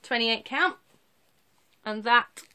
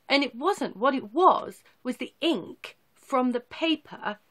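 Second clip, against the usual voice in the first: normal talking voice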